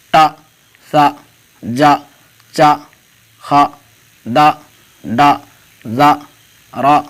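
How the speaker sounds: each syllable spoken alone with a pause after it; bare syllable drill. Shown as a demonstration. Urdu teacher reading only the zabar syllables for students to echo. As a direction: chant the aa sounds one by one for repetition. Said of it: "اس کو اس طرح بھی پڑھ سکتے ہیں".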